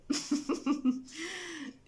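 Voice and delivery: high, light laugh at herself; self-conscious giggle. Real, not acted. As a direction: giggle at your own accent. Laughs at own English accent.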